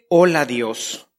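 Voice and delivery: tender talk with God; intimate prayer. Shown as a demonstration. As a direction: lean in and tell your dad a secret.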